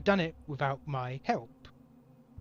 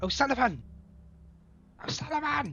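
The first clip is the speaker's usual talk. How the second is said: higher, strained whisper shout; whisper yelling; 10 semitones higher than usual. Acted. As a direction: yell it but keep it hushed. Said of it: "like, whisper shouting".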